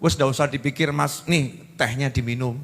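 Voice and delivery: calm him down as you hand him tea; soothing wife. Retold or imitated. Wife offering her husband tea, gently soothing.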